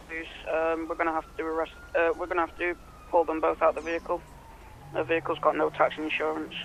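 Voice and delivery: ordinary delivery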